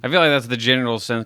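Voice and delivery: usual speaking voice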